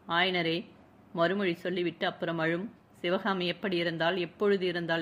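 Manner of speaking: plain reading voice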